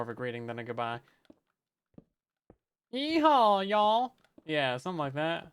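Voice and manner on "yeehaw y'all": high, loud hammed-up Southern twang; mock Southern drawl, high and loud; thick Southern accent. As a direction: belt a cowboy yeehaw in a thick Southern accent. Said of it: "Thickest accent possible".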